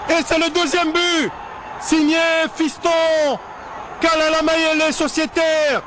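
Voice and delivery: excited sports commentary. Real, not acted. Goal called in live commentary.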